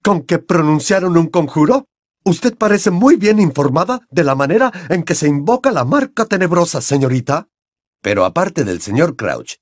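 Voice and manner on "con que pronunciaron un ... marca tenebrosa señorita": raised, accusing interrogation; suspicious. A narrator's reading. Crouch interrogating Hermione, suspicious. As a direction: insinuate that she knows too much. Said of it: "con la desconfianza impresa".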